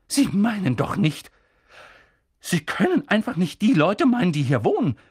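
loud, high protest; shocked outcry; loud, high alarmed cry; cried out. A narrator's reading. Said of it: "rief Professor McGonagall".

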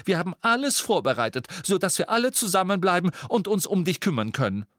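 high, fast worried mother building up; fretting mother pleading; anxious motherly insistence. Acted. Mrs Weasley insists, voice rising.

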